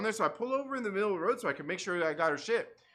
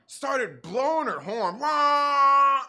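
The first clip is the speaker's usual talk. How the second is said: loud, higher imitated honk; mock horn blast; 6 semitones higher, 9 dB louder, much slower than usual. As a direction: blare it like a horn.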